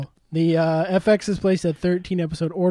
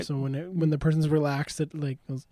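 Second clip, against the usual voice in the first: normal talking voice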